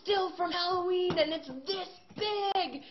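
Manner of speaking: eccentric fan. Retold or imitated. Pumpkin man explains keeping his pumpkin fresh, high and earnest. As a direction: explain your pumpkin secret like it matters deeply.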